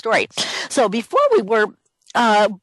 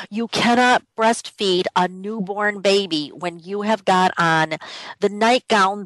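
ordinary delivery

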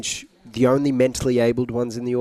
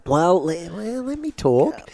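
higher, slow, teasing let me talk; mock indignant; 6 semitones higher, slower than usual. Guest jokingly telling the host to let him finish. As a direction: playfully put the host in her place.

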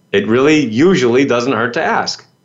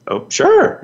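eager, much higher "Oh, sure"; excited. Retold or imitated. Student accepting the assistant's offer to hold, excited. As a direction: jump at the offer, thrilled. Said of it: "I was very excited about that".

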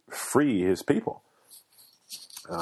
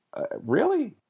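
"really" flung up high in disbelief; incredulous scoff. Real, not acted. Host scoffing at oppression over skin color, incredulous high "really". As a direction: scoff at the idea, pitch soaring.

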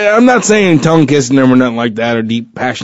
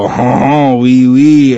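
mock French accent; 5 dB louder, much slower than usual. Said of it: "he's a French guy".

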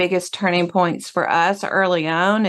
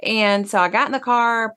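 ordinary talk

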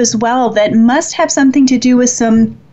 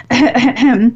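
a quick real throat clear. Real, not acted. Pausing the livestream to clear her throat. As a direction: clear the throat mid-sentence. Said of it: "I'm gonna clear my throat".

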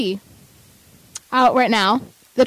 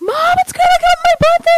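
very high, loud squeal to mom; shrieking with birthday excitement; screaming excitedly; 6 dB louder, faster than usual. Retold or imitated. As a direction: re-enact an excited scream across the house. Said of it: "screaming and running across".

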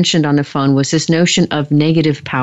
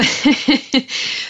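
a short airy laugh, pitched up; breathy high laugh; 9 semitones higher than usual. Real, not acted. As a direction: laugh lightly at your own joke. Laughs after yummy remark.

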